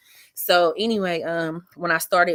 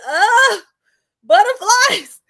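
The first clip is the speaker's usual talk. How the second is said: much higher and louder, panicked squeal; freaking out; 14 semitones higher, 7 dB louder than usual. Retold or imitated. Comedian spotting celebrities in the crowd before her set, panicked high squeal. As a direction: squeak it out as if stage fright just hit. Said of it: "me and B Simone both freaking out".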